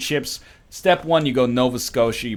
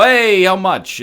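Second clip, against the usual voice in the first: high, loud acted call; loud mock-brash question; 8 semitones higher, 9 dB louder, slower than usual. Rude customer asking the price, loudly brash. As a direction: fire the question back brashly.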